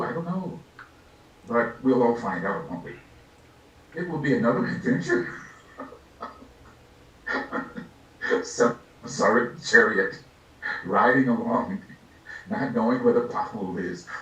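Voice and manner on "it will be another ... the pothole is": normal talking voice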